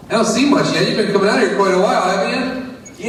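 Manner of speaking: lightly voiced skeptical neighbor; doubtful neighbor. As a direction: poke at him gently.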